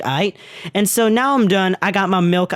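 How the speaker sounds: ordinary talk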